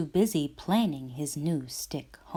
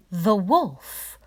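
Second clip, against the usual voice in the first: plain reading voice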